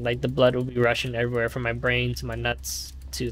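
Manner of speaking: normal talking voice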